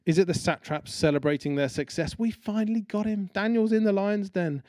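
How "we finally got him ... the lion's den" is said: higher, gleeful gloating; gloating celebration. Acted. Satraps partying over Daniel's capture, gleeful and high. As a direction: crow about catching him.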